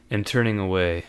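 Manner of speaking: usual speaking voice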